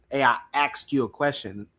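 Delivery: "asked" pronounced "axed"; ask said as axe. Retold or imitated. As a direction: swap the consonants in "asked" to sound like "axed". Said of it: "I used to say axe".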